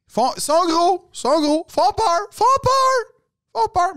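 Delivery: very high, loud acted yelling; grand speech. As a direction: hammer out each phrase like a desperate sports pep talk. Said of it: "t'as le grand discours".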